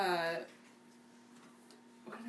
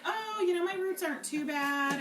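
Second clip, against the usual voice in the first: breezy self-quote; 9 semitones higher, 4 dB louder than usual. Retold or imitated. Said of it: "and I was like".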